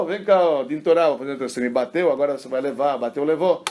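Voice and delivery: loud, high mock outrage; indignant retort. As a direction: imitate someone demanding payback at full voice. Imita qualquer um revidando, indignado. (revide indignado imitado, alto e agudo)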